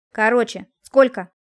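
loud, impatient interruption; angry bark into the phone; sudden bark. A narrator's reading. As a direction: cut in sharply, rising in volume. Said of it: "неожиданно рявкнул в трубку Привольнов".

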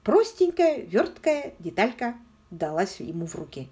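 ordinary delivery, nothing marked

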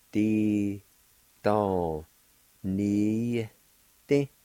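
"Di, don, ni, ti" said slowly, one syllable at a time with a pause after each. Shown as a demonstration. Teacher shows how to say embrace, syllable by syllable. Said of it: "like this".

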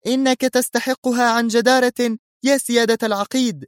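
high-pitched woman's joyful salute; gleeful. A narrator's reading. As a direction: call it out with delight. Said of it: "تهتف وتؤدي التحية العسكرية في جذل".

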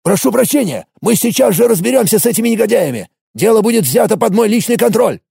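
high, fawning apology; obsequious. A narrator's reading. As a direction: grovel to his superior. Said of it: "Голос полицейского резко изменился".

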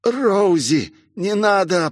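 сдавленная мольба высоким голосом; прохрипи высоко, через силу; хрип. A narrator's reading. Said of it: "прохрипел Мессу". (хрипеть от боли)

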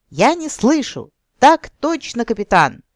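high, loud call and answer; shouting. A narrator's reading. SpongeBob chant call and answer.

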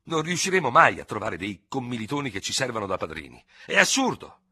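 protest, peaking on the last words; exasperated protest. A narrator's reading. Dubert argues no comrade will act as second, exasperated.

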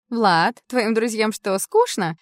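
loud, annoyed interruption; sharp loud retort. Acted. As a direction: cut in loudly, irritated. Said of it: "вмешалась Ясмина".

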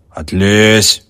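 host snapping at the coachman; gruff shout. A narrator's reading. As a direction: shove him off with your voice. Host shoves coachman back.

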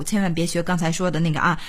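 ordinary talk

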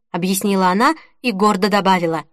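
ordinary delivery

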